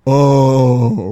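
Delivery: long, loud mock-shocked "oh"; imitated wide-eyed dread, loud. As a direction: draw it out as dawning alarm. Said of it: "shock wide open".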